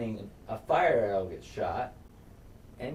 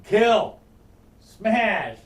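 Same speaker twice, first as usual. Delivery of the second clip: punchy exclamation; 10 semitones higher, 9 dB louder than usual. Retold or imitated. Retelling the brutal fight.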